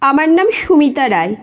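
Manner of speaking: ordinary delivery, nothing marked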